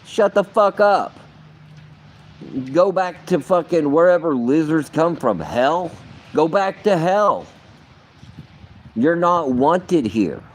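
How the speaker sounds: high, heated rant at the reptilians; yelling at the lizards. Retold or imitated. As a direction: run them out of town with your voice. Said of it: "And I'm like".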